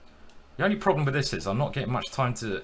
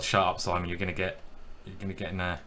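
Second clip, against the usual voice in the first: ordinary talk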